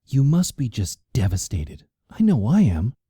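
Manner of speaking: milk the grief; drama queen. A narrator's reading. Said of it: "she was a real drama queen".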